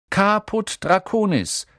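loud, deliberate incantation; announcing a password. A narrator's reading. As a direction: declare it ceremoniously, drawn out.

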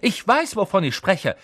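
high-pitched curt rebuke; stern, snappish insistence; brusque. A narrator's reading. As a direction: cut the pupil off sharply. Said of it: "sagte sie barsch".

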